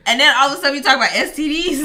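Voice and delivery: fast, laughing complaint. Real, not acted. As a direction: rush it out while laughing.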